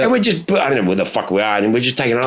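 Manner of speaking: ordinary talk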